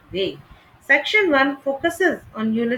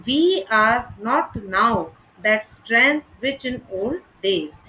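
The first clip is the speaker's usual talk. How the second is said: slow, even weight on each word; slowed line with heavy stresses; nearly every syllable stressed; slower than usual. Shown as a demonstration. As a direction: plod through every word of "We are not now that strength". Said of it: "almost every syllable could be read as stressed".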